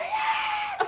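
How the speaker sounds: high, loud whooping yell; excited cheer. Retold or imitated. Crowd cheering for the candidate, a loud high whoop. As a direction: cheer like the place just erupted. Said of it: "it's just like".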